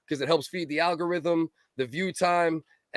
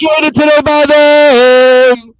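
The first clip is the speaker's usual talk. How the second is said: teasing; 8 semitones higher, 18 dB louder, slower than usual. Real, not acted. Teasing a friend over and over.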